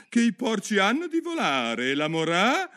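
loud, very high-pitched character voice; Duchess. A narrator's reading. Duchess moralising to Alice, high loud voice. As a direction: declaim high and loud, cut off mid-word. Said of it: "disse la duchessa".

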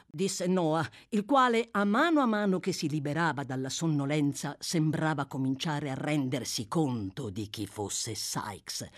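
ordinary delivery, nothing marked

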